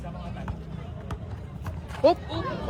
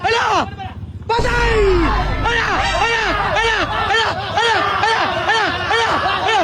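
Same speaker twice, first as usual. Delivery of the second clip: high-pitched, repeated cries of shock; worked-up courtside alarm; alarmed exclaiming; 4 semitones higher than usual. Real, not acted. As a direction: yelp each word in short, high bursts. Alarmed reaction to rough play.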